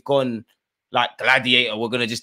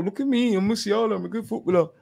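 higher, show-off mock voice of a preening player; mocking Musiala; 8 semitones higher than usual. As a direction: sneer like a vain footballer showing off. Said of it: "these Love Island guys".

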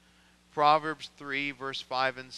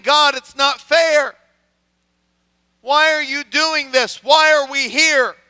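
loud, high, anguished protest; pleading prayer; 11 semitones higher, 15 dB louder than usual. Acted. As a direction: demand answers from God in pain. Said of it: "You can imagine his prayer".

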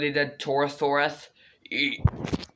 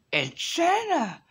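excited squeal; 11 semitones higher, slower than usual. Pretend. Excited high call.

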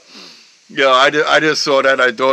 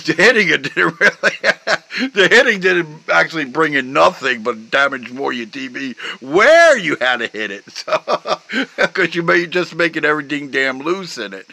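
laughing through the words; 4 semitones higher than usual. Real, not acted. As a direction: talk while cracking up. Laughing at own story.

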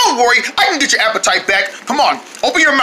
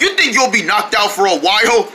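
ordinary talk

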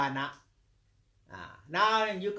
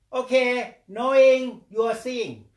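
loud stressed demo; 7 semitones higher, 13 dB louder than usual. Shown as a demonstration. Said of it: "teacher demonstrates loudly".

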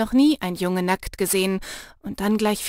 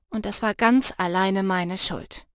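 ordinary delivery

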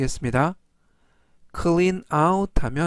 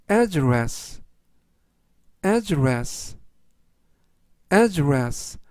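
slow word reading; much slower than usual. Shown as a demonstration. Teacher reviewing words for learners to follow, slowly. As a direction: address read out slowly, falling at the end. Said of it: "제가 천천히 세 번씩 읽어드리고요".

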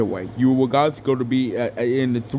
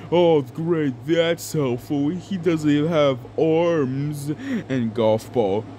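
normal talking voice

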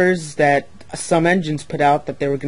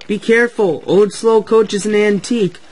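raised, louder protective warning; scolding warning; 6 semitones higher, 7 dB louder than usual. A narrator's reading. Engine defending the old coach, scolding warning. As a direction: warn the rough engine off. Percy scolds Emily for rough pulling.

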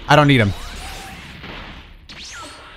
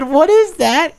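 high mock voice of a baffled civilian; mocking the victim; 10 semitones higher, much slower than usual. Streamer imitating the civilian he just killed, high and mocking. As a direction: squeak it out like the confused little guy. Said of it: "He said".

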